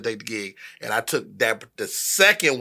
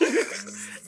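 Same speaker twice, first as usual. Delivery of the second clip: laugh; 9 semitones higher, 8 dB quieter than usual. Real, not acted. Laughs after punchline.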